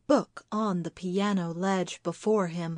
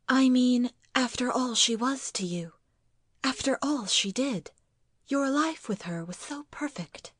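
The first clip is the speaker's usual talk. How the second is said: higher voice, earnestly explaining herself; earnest wife; 4 semitones higher than usual. Acted. Wife explains to husband about his mother, earnest. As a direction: voice the wife higher, wistful and admiring.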